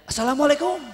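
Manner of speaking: high, bright rising greeting; happy salam. Shown as a demonstration. Preacher mimicking a joyful man who just inherited, high. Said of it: "itu baru dapat warisan".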